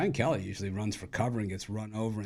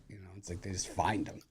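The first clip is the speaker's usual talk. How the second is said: whisper; 2 semitones lower, 6 dB quieter than usual. Real, not acted. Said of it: "confiding aside".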